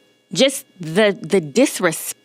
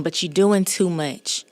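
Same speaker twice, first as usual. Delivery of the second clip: ordinary talk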